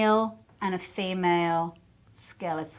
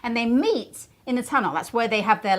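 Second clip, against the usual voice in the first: usual speaking voice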